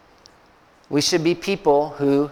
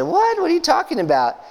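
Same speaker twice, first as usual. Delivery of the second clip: much higher, incredulous What; astonished; 12 semitones higher than usual. Retold or imitated. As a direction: pastor taken aback by his people. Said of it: "And he said".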